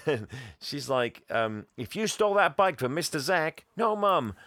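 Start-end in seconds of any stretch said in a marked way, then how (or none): 1.8-3.6 lightly voiced higher-pitched mother; mock motherly accusation, pitched up; scolding mum. 3.8-4.3 high-pitched mock protest to mum; whiny retold denial; protesting boy.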